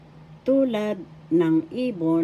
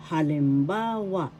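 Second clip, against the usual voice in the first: halimBAAwa, teacher's careful demonstration; stress on third syllable. Shown as a demonstration. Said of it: "Third syllable has the accent".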